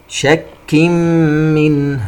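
very slow chant, "شك" hummed into doubled m of "منه"; idgham with ghunna. Shown as a demonstration. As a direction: hold the m-hum in "شك منه". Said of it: "حرف الميم إدغام بغنة". (ترتيل بغنة "شك منه")

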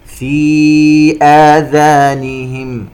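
slow chant, long ee in "في"; separated madd. Shown as a demonstration. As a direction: stretch "في" four counts before "آذانهم". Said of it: "مد منفصل يمد بمقدار أربع حركات". (ترتيل بمد "في" قبل "آذانهم")

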